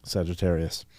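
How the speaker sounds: very soft exasperated aside; inner voice. Retold or imitated. Ninth grader grumbling inwardly about friend, very soft aside. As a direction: think it aloud, hardly any volume. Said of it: "Like, in my head".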